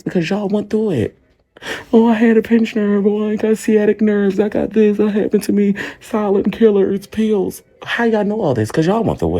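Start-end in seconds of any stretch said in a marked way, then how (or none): 1.9-7.6 high-pitched run-on mock recital; mocking commenters. Streamer mimics commenters diagnosing her in the chat, mocking. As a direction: reel it off higher, imitating know-it-all commenters.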